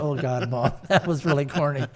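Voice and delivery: laughing through the words; amused groan. Retold or imitated. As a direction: tease fondly with a chuckle. Teasing mom's line while laughing.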